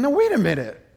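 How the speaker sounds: high, loud puzzled thinking aloud; baffled inner voice. Retold or imitated. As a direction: sound baffled by the contradiction. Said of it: "I'm thinking".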